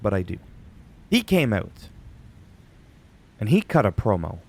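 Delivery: normal talking voice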